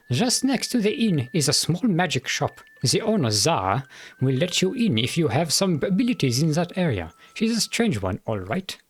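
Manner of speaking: put-on the sheriff in a mild German accent. Sheriff telling the adventurer about the town, light German accent. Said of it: "me trying to do a German accent".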